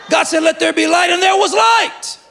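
full-force preaching of let there be light; proclaiming shout. Acted. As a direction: shout the verse like a revelation. Preacher proclaiming Genesis verse.

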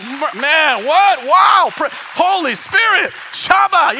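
high, shouted astonishment; retold amazement. Preacher reacting to the broker's conversion, shouted amazement. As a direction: burst out amazed, as if reliving it.

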